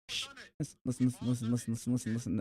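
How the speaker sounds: soft, repeated urging; quiet him down firmly; hushed insistence. Real, not acted. Shushing sympathetic friend.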